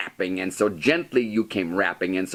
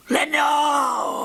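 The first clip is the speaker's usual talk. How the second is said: high, drawn-out call that rings like an echo; echoing cry. A narrator's reading. The echo answering from the darkness with a high ringing cry. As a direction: let the name ring back from the dark. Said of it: "an echo murmured back the word".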